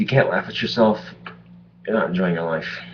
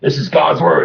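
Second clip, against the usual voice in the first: zealot voice; 8 semitones higher, 8 dB louder than usual. Retold or imitated. Mocking crazy zealots.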